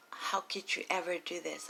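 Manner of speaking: usual speaking voice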